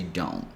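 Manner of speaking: "Don't" with no final T, softly; "Don'" softly; dropped T. Shown as a demonstration. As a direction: drop the T from "Don't". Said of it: "completely drop the T sound here and say".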